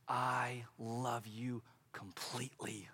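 soft, slow I love you; tender reassurance. Retold or imitated. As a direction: say it gently and slowly to a child. Telling four-year-old he's loved.

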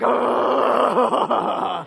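long, loud "ugh"; exasperated groan. Acted. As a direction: groan it out like God fed up. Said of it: "God's like".

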